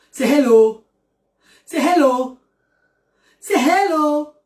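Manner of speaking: patient parent demonstrating hello; coaching a child. Shown as a demonstration. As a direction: model the word hello for a child. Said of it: "Dis alors hello".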